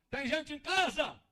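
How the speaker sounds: chamado alto, arrastado e mais agudo; chamando de fora. Acted. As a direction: chame quem está dentro da casa. (chamando em voz alta)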